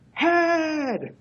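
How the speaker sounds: high, drawn-out mock crowd shout. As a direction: shout it back like an arena crowd. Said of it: "the crowd would shout".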